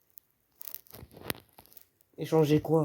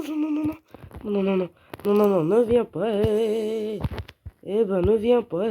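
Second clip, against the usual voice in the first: rapid, higher-pitched string of protests; panicked pleading; 5 semitones higher, 4 dB louder than usual. Real, not acted. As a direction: ward off an attacker in a panic. Begs attacker to stay away. (supplication paniquée)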